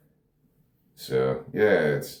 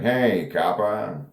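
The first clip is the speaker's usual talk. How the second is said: put-on drawn-out, higher old crime-drama call; crime drama stock voice; 5 semitones higher, much slower than usual. Actor reading Devil's Dialogue like old crime dramas, hammy stock voice. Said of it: "like that stupid voice from the".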